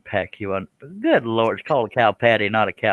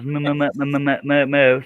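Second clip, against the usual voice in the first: stuttering cow; 4 semitones higher than usual. Retold or imitated. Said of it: "that cow had a stutter".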